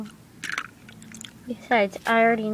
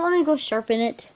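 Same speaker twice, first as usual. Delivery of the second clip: ordinary talk, nothing marked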